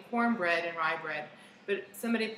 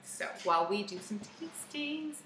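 ordinary talk

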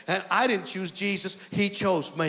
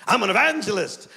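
indignant retort; 4 dB louder than usual. Retold or imitated. Preacher correcting a doubting elder, indignant retort. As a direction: burst out with the correction. Defensive correction after a meek denial.

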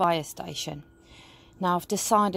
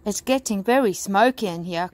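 ordinary delivery

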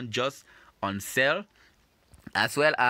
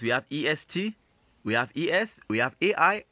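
normal talking voice

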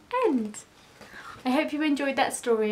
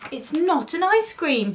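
higher, small squeaky voice; squeaked protest; 6 semitones higher than usual. A narrator's reading. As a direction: squeak out a little denial. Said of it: "he squeaked".

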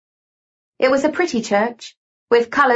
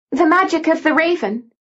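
lightly voiced, higher-pitched woman; Lucy's character voice; 6 semitones higher than usual. A narrator's reading. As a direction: lift the pitch for the woman's line. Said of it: "said Lucy".

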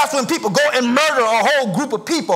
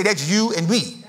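slow, deliberate, a bit softer; slow call-and-response; 6 semitones lower, 3 dB quieter than usual. Shown as a demonstration. Preacher has congregation tell each other, slow and deliberate. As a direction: lean in and say it slowly to your neighbour. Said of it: "Look at somebody and say".

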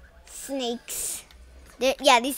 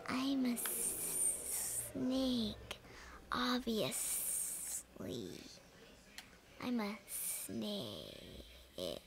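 soft hissy whisper, very slow; whispered snake voice; 13 dB quieter, much slower than usual. Retold or imitated. Imitating the game's snakes to viewers, slow soft whisper. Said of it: "They literally make a".

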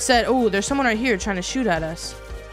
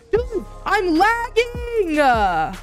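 exasperated; 9 semitones higher, much slower than usual. Real, not acted. Lag while grappling in fight.

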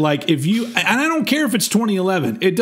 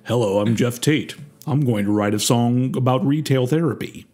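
lower, carefully enunciated singer voice; 6 semitones lower than usual. Retold or imitated. Mocking the singer's song idea, lower, over-enunciated. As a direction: enunciate every word like a pompous frontman. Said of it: "his accent is more just like".

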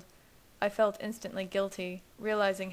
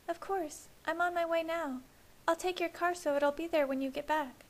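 put-on much higher, worried young woman; Alice voice; 10 semitones higher than usual. A narrator's reading. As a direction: voice the anxious sister.

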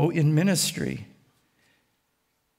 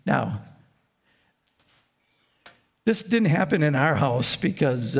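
normal talking voice

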